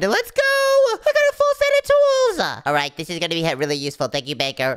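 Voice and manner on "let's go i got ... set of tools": loud, high, slow cheer; excited cheer. Acted. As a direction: shout it out with joy. Gets tools, cheers loudly.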